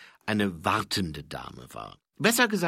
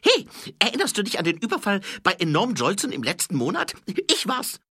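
high, loud, hurried character voice; eager boastful thief; 12 semitones higher, 7 dB louder, faster than usual. A narrator's reading. Thief claiming a robbery to the watchman, high eager shout. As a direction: brag loudly in a high-pitched put-on voice.

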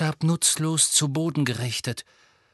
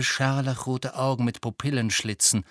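usual speaking voice

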